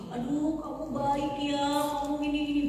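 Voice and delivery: lightly voiced sweet-talking groomer; coaxing groomer.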